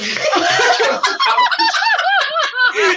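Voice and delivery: high, loud burst of laughter; squealing laugh at the punchline; loud shrieking laugh. Real, not acted. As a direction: crack up at your own story. Laughing at own story.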